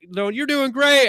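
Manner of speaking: high, loud, slow cooing praise; gushing encouragement. Retold or imitated. Cluster cooing at nervous Bob, high and loud. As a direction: pump him up like a proud parent.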